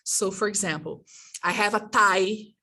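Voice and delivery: ordinary talk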